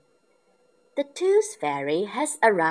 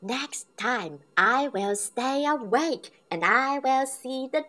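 Peppa determined; 9 semitones higher, 5 dB louder than usual. A narrator's reading. Peppa vows to stay awake.

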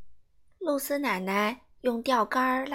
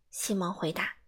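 ordinary delivery

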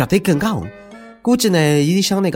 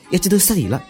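ordinary talk

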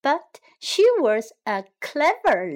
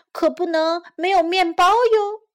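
high, loud chick voice; little chick; 7 semitones higher, 5 dB louder than usual. A narrator's reading. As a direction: pipe up as the clever chick. Pip stalls the fox.

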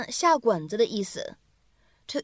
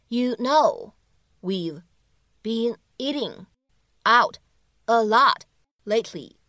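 slow, each word set apart by pauses; slower than usual. Shown as a demonstration. Teacher breaking the line down for learners, word by word. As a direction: isolate every word for learners.

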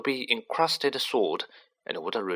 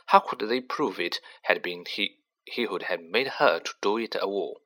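ordinary delivery, nothing marked

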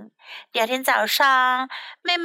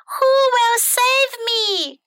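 very high, drawn-out damsel cries; princess crying for help; 10 semitones higher, 3 dB louder, slower than usual. A narrator's reading. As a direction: call out theatrically for a rescuer. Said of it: "cried the pretty princess again".

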